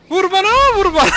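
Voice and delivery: high, loud catchphrase imitation; mock yell. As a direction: yell the catchphrase as a parting joke. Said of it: "diye bağırdı az önce". (taklit ederek tiz sesle bağırma)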